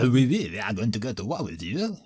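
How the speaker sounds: sing-song, swooping French accent; mock French accent. Shown as a demonstration. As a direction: show off a silly, over-the-top French accent. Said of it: "some, some French accents".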